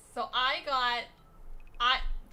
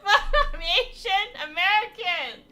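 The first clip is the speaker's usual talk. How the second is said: laughing; 8 semitones higher, 10 dB louder than usual. Real, not acted. Laughing at her own joke.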